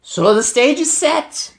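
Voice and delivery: high, loud, slow witch shout; triumphant witch shout. A narrator's reading. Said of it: "shouted the Grand High Witch".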